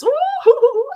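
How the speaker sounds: high squealed ooh; delighted ooh. Real, not acted. Host thrilled by a viewer's line, squealing ooh.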